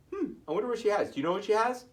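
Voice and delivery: plain reading voice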